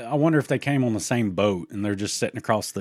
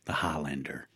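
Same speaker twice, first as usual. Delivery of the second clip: lower, softer dramatic movie-trailer delivery; 7 semitones lower, 8 dB quieter than usual. Acted. Host quoting the Highlander film in a lower dramatic voice. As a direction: drop into a solemn film-quote voice.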